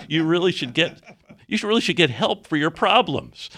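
loud scolding. Retold or imitated. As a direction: scold him loudly like a fed-up old man. Franklin's scolding reply retold.

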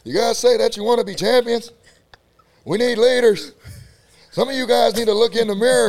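loud, high coach roar at the team; imitating the coach in the weight room, loud and high; Coach Glass impression. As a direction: yell like a strength coach demanding leaders. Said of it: "Coach Glass".

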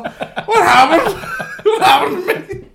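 high, loud words breaking into laughter; laughing through it. Retold or imitated. Recalling being wiped out after the match, laughing hard. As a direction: crack up while playing your dazed drunk self. Retelling drunk confusion after rugby.

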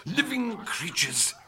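high, loud, slow exclamation; thrilled wonder. Acted. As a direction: savour it with soaring excitement. Scientist thrilled by the discovery.

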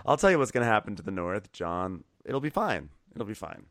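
normal talking voice

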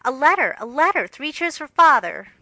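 high, loud joyful shout; girl shouting joyfully over a letter from father; excited cry. Acted. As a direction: cheer it out with delight.

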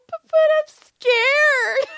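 very high, loud, slow stammering cry; stammer, then wail it like a scared child; hammed-up tearful shriek; mock terrified wail. Retold frightened reply, repeated bigger.

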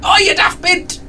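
very high comic yell after someone; exasperated holler. Acted. Player yelling after the fleeing maid, high comic holler. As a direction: yell it out of the window in mock outrage.

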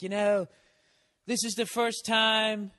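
milk it with a pause; imitating a band singer. Comic mimics a club singer's dramatic pause, slow and grand. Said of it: "the guy was doing that".

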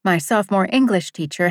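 plain reading voice

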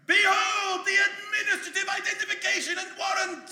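loud high heroic proclamation; triumphant shout. Acted. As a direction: proclaim it to the hall. Hero brandishes warrant, shouting.

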